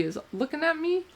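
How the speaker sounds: ordinary delivery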